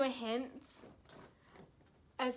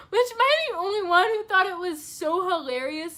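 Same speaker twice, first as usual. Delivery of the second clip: laughing; 7 semitones higher, 10 dB louder than usual. Real, not acted. Laughing about Thanos garden scene.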